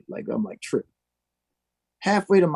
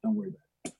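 soft whispered reassurance; hushed, secretive aside; 7 dB quieter, much faster than usual. Retold or imitated. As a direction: lean in and whisper it so nobody hears.

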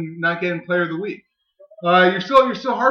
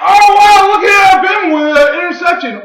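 much higher, louder burst of excitement; real excited shout at an interception; 11 semitones higher, 9 dB louder than usual. Real, not acted. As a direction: yell it like a fan at the TV. Sudden interception in the game.

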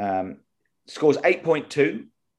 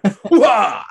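high, loud soldier battle cry; loud gung-ho soldier; 9 semitones higher, 9 dB louder than usual. Retold or imitated.

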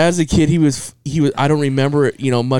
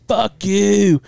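mock drunken defiance; 8 semitones higher than usual. Drunk uncle defies the mother.

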